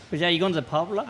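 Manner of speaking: casual question tailing into a lazy "la"; slangy "la" ending. Shown as a demonstration. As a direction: tack "la" on the question, an open vowel with no k. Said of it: "so we just say la".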